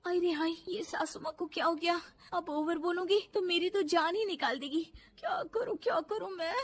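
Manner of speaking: high, whimpering dismay; panicked fretting. Acted. Daughter-in-law finding mother-in-law frozen, panicked. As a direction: fret helplessly, rising into a whine.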